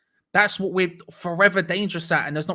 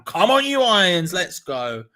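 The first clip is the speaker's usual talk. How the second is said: 1 cheering; 3 semitones higher, 6 dB louder, slower than usual. Real, not acted. Fan cheering his team.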